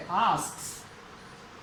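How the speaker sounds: आस्क्स slowly, with a long hissed स्क्स ending; pronunciation demo. Shown as a demonstration. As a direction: draw out the final consonants of आस्क्स. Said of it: "के और से".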